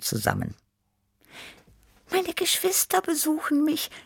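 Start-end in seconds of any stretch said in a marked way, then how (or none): 2.1-3.9 very high, happy kitten voice; high-pitched cheerful cat; happy miaow. A narrator's reading. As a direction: miaow it gladly in a tiny high voice. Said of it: "miaute Tibi Tiger froh".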